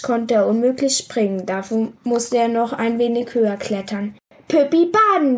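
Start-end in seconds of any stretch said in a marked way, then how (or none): 4.5-5.4 put-on toddler. A narrator's reading. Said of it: "wiederholte das Riesenkind".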